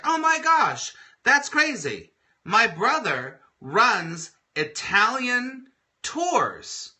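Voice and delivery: gushing with surprise about her brother's tours; excited coworker. Retold or imitated.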